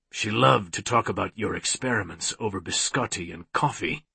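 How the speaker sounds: low, soft, menacing villain voice; creepy taunting villain. A narrator's reading. Villain taunting his former prisoner, creepy and soft. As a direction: taunt him quietly and low.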